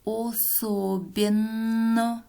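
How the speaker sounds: «Особенно» drawn out with the double «нн» held long; stressed spelling. Shown as a demonstration. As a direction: say «Особенно» slowly, lengthening the double н. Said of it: "Двойной согласный «н» в этом слове".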